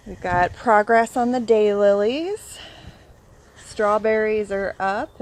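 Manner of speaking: ordinary talk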